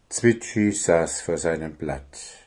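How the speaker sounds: ordinary delivery, nothing marked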